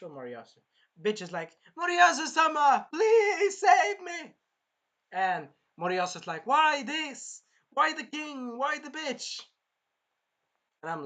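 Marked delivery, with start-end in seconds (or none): 1.8-4.3 high, loud wailing cry for help; hammed-up damsel begging to be saved; desperate plea. Acts princess begging to be saved. 6.5-9.4 high-pitched hammed-up lament; retold hero crying out why; anguished protest. Acts Moriyasu protesting in anguish.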